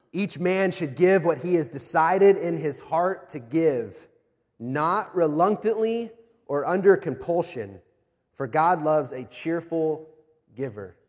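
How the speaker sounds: slow, emphatic reading of the verse; slow pulpit scripture reading. Acted. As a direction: lay each phrase out deliberately. Reads scripture slowly.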